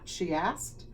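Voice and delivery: ordinary delivery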